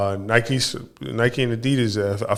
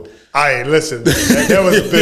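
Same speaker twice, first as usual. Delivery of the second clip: laughing, louder, a slow mock reprimand; laughing scold; 3 semitones higher, 6 dB louder, slower than usual. Real, not acted. Cohost telling partner he went too far, laughing. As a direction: scold him while cracking up.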